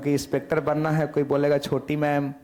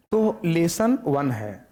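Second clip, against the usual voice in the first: slow announcement; much slower than usual. Shown as a demonstration. Teacher announces the first lesson.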